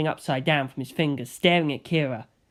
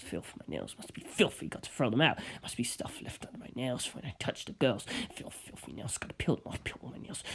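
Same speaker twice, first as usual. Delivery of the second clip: much softer, obsessive whispered muttering; muttering under breath; 6 semitones lower, 9 dB quieter than usual. A narrator's reading. Traumatized Kira obsessing over filth, muttering under his breath. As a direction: mutter it half to yourself, unhinged. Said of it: "muttering under his breath".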